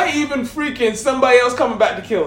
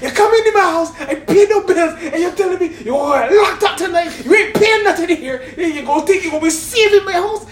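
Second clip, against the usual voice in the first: angry mother rant; 6 semitones higher than usual. Retold or imitated. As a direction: tear into the son. Mother yelling at jailed son.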